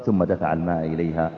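ordinary talk

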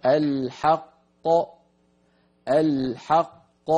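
"الحق" بقافين مبيّنتين ببطء شديد; أظهر القاف الأولى والثانية ثم قلقل; قلقلة القاف. Shown as a demonstration. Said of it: "ينطبق على الكلمة التي بعدها". ("الحق" وقفًا مع قلقلة واضحة)

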